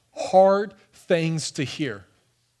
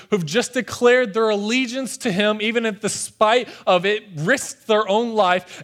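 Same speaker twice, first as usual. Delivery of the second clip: ordinary delivery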